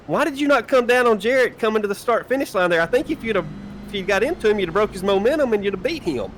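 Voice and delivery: put-on high, eager kid questioning; lightly voiced eager teenager; eager teen voice. Teen lecturing Davey on racing.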